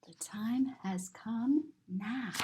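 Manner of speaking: slow, soft whispered line. Acted. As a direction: breathe it like a secret. Story text whispered.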